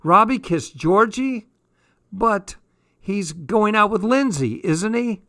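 high, incredulous call; high-voiced mum's astonished question. A narrator's reading. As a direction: join the shouting, pitched right up. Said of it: "Jazz's mom joined in".

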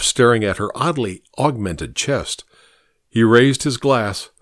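ordinary delivery

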